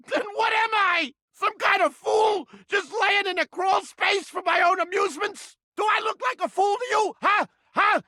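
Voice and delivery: angry rant. Acted. Mousetrap yelling at the visitor, furious. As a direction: rage at the stranger, voice climbing.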